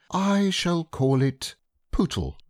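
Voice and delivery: naming the pig; a hint of a pleased boy. Christopher Robin voiced.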